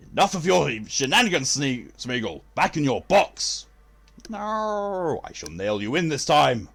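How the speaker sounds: loud, theatrical command with drawn-out words; mock scolding. Streamer banishing Smeagol to the box, hammed-up scolding. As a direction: order the creature away in hammed-up outrage.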